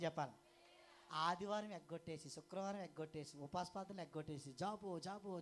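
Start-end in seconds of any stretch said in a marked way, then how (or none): none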